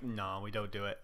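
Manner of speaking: soft, flat-out no; deadpan refusal. Retold or imitated. Barman turning down a customer's special drink, deadpan. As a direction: refuse without a second thought. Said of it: "I'm like".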